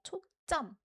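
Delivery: 초점 with a strong, tense 점; short 초, then punch 점; stress on second syllable. Shown as a demonstration. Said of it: "put an emphasis on the second syllable".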